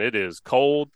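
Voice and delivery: ordinary delivery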